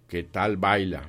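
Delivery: plain reading voice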